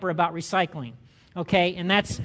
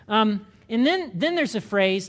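usual speaking voice

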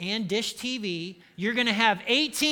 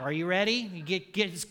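normal talking voice

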